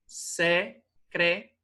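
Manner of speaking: "Secretária" in four slow pieces: se - cre - tá - ria; syllable by syllable. Shown as a demonstration. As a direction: break "Secretária" slowly into syllables, ria kept as one. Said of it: "esse tá vibrou mais".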